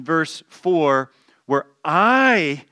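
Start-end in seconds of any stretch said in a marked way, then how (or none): 1.8-2.6 "I" popped high and loud; stressed I. Shown as a demonstration. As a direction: punch the single word "I". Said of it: "is emphasized".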